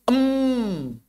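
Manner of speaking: Em hummed long and high through the nose; overdone nasal mim. Shown as a demonstration. Teacher demonstrating the wrong nasal mim sukun, high hum. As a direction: show the wrong, dragged-out nasal em. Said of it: "Nggak perlu begini".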